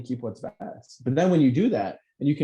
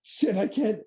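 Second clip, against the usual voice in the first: higher, fretful mock worry; mock panic; 9 semitones higher, slower than usual. A host mimics listeners who panic over the ego, mock fretting. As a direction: play the worried listener, half-joking.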